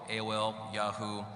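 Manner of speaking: ordinary delivery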